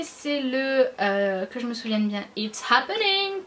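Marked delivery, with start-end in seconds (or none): 2.4-3.4 high, drawn-out sing-out on the shade name; Gérard Brandino catchphrase. Retold or imitated. Vlogger imitating a video creator for her viewers, high drawn-out catchphrase. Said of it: "comme il fait, euh, Gérard Brandino".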